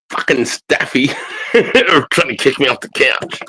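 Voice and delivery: slowed, chuckling through the words; laughing while talking. Real, not acted. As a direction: laugh at the dog as you say it. Dog pushing him off couch.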